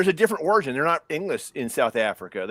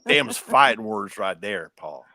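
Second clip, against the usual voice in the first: slow, lower Southern drawl; mock Southern accent; 6 semitones lower, slower than usual. Host answering the hillbilly's challenge, mock Southern drawl. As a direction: put on a slow Southern warning. Plays along as Southern local.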